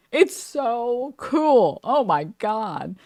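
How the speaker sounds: drawn-out, emphatic gushing; gushing admiration. Retold or imitated. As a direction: stretch the words out in slow swooning admiration. Gushing over deep female voices.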